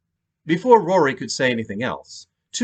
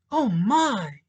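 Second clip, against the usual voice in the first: higher voiced widow, startled; surprised gasp; 11 semitones higher than usual. Widow to detectives, suddenly startled. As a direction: caught off guard by the phone. Monica sees unexpected text.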